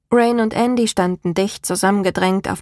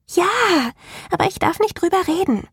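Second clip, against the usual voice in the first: put-on high little girl voice, firm and quick; more emphatic; 10 semitones higher, faster than usual. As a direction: insist on it, a touch more forcefully. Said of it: "mit etwas mehr Nachdruck".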